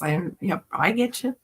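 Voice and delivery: ordinary talk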